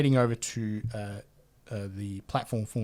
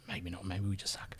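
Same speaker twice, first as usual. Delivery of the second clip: whisper; 4 semitones lower, 11 dB quieter than usual. Real, not acted. Self-deprecating aside after laugh.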